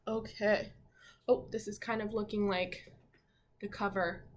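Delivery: ordinary delivery, nothing marked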